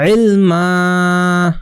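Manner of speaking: ইলমা drawn out very slowly, the final a held; one alif stretch. Shown as a demonstration. Teacher modelling ইলমা slowly for the class. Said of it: "এখানে এক আলিফ টানতে হবে".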